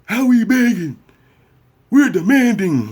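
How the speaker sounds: very high, loud indignant retort; indignant activist. Retold or imitated. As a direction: fire it back as an offended activist. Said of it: "You're like".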